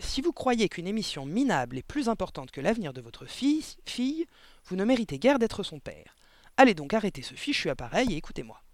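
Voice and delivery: impatient teacher taking a parent to task; losing patience. A narrator's reading. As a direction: tell him off with mounting impatience. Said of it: "commençait à perdre patience".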